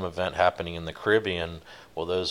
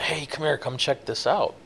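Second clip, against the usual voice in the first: retold yell; 8 semitones higher than usual. Watchman calls skipper up from the cabin, mild mock yell. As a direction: imitate a crewmate calling out, volume held back. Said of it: "I hear him yelling, say".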